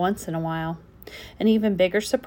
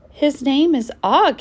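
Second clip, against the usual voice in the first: Og held slow, higher and louder; stress on Og; 6 semitones higher, 6 dB louder than usual. A narrator's reading. Wife correcting her husband, stressing Og. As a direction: lean on Og with a rising tone.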